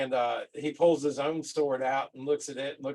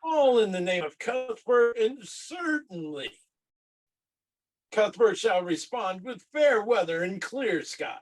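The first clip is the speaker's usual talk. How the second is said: fervent in-character oratory; preaching cleric; 5 semitones higher than usual. Acted. Cleric urges a sacrifice for fair weather, rousing sermon. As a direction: proclaim it like a zealous priest to a crowd.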